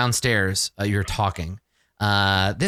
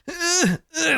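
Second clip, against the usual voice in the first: strained grunt of heaving furniture; effort grunt. Acted. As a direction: grunt as if dragging a heavy sofa. Miming the sofa being dragged.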